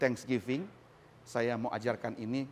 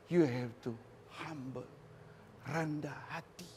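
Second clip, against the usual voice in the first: softened; 10 dB quieter than usual. Acted. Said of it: "drops voice on humble".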